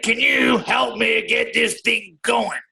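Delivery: slowed right down for someone who missed it; slow, emphatic repeat. Real, not acted. Fighter repeats his request to a friend who missed it, slowly. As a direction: spell it out slowly, word by word.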